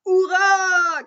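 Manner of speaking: loud, high triumphant hurrah; shouted victory cry; crowd cheer. Acted. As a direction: cheer like a whole village. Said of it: "crie la foule".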